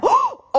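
loud sudden gasp; sharp gasp. Acted. Sudden realization.

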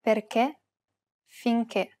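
"Perché, finché" with a closed final E; "Perché" and "finché", each ending on a closed E. Shown as a demonstration. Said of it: "pronounce it as a closed E".

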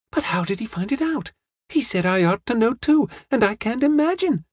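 put-on very high, puzzled girlish voice; young woman, baffled. Young woman asks how he found out, baffled and very high. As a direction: let the pitch climb as she puzzles it out.

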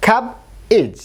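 Cab and ইজ said apart with a pause; syllable split. Shown as a demonstration. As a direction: break cabbage into cab then ইজ. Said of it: "এই এর উচ্চারণ হচ্ছে ই". (Cab আর ইজ আলাদা করে)

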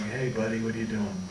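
soft, hushed hey buddy; tender greeting. Retold or imitated. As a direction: greet an old friend gently. Tender reunion holding Luana's hand.

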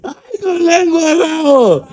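high, slow teasing squeal; mock swoon. Host teasing man just kissed, mock swoon. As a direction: draw it out high, then let it fall.

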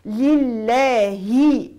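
لله ending in a full clear kasra; pure kasra on the ha. Shown as a demonstration. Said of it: "كسرة الهاء".

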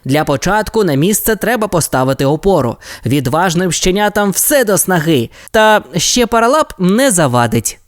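leader plan. Acted. Ryder explaining the fix.

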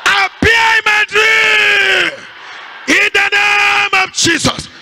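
high, long-drawn preacher's shout; full-force prayer call for the crowd to follow. Shown as a demonstration. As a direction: cry it out slowly, stretching the vowels. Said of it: "Raise your voice now".

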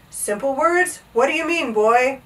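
lightly voiced indignant king; affronted king. A narrator's reading. King questions Bartholomew's advice, mildly affronted.

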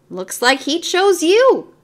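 barker. A narrator's reading. Mario teases.

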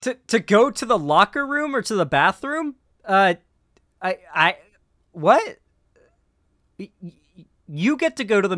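incredulous disbelief. Real, not acted. Incredulous reaction to clip.

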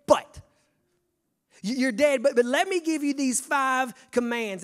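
loud, high, stretched-out preacher's call; full-force preaching. Acted. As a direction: preach it out to the congregation, big and rising.

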